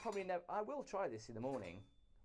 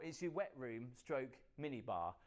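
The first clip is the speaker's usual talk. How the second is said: ordinary delivery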